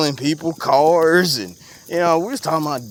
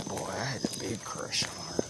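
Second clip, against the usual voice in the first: whispered confession; 5 semitones lower, 14 dB quieter than usual. Real, not acted. Admitting an old crush to his friend, in a whisper. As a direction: whisper it low and secret, a little embarrassed.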